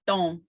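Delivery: don with a nasal O; nasalized Kiowa vowel. Shown as a demonstration. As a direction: hum the O of don through the nose. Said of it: "if you wanna practice that".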